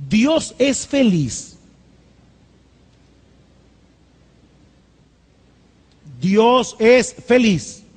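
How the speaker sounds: "¿Dios es feliz?" drawn out slowly; slow rhetorical question. Acted. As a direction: ask it slowly, let it hang. Pregunta retórica repetida lenta. (pregunta lenta y retórica)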